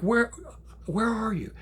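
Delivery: high, halting, urgent question; urgent alarm. Retold or imitated. Grandpa asks granddaughter her location, urgent alarm. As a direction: demand it in rising alarm.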